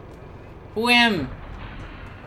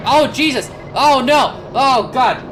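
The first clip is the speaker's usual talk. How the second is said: sudden loud higher yelps of alarm; real startled yells, louder; alarmed cries; 5 semitones higher, 5 dB louder than usual. Real, not acted. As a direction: cry out as the machine lurches. Mech lurches out of control.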